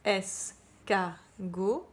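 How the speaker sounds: "escargot" said very slowly, syllable by syllable; teacher slows "escargot" to show the syllables; syllable split. Shown as a demonstration. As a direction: cut "escargot" into slow syllables. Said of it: "quand on regarde la syllabe".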